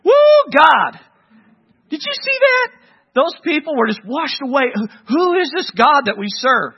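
high, loud whooping praise, awestruck; rejoicing shout. Retold or imitated. Israelites cheering God after crossing the sea, loud and high. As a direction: cheer like freed people who just watched the sea close. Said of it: "They're rejoicing. They're happy".

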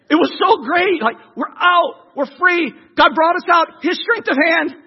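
high, loud excitement; elated. Acted. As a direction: gush like someone just set free. Voicing the excited freed people.

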